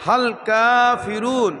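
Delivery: হাল কাফিরুন with a fully open, released voice; open throat. Shown as a demonstration. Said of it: "গলা ছেড়ে বলতে হবে".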